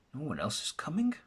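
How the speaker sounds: a fast, hushed whisper of disbelief; stunned whisper. Acted. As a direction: breathe it out, shocked. Jackson at his own funeral.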